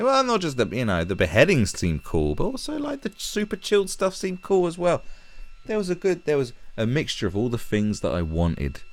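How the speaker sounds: put-on high-pitched naive girl voice; mocking impersonation. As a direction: mimic her, pitched well up. Mocking the girl's interview answer.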